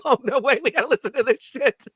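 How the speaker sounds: high mock-excited voice; high, bouncy mock eagerness; mock prince. Commentator voicing the smiling prince, mock-eager in a high voice. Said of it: "He's like".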